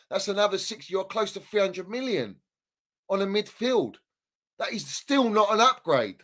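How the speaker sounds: usual speaking voice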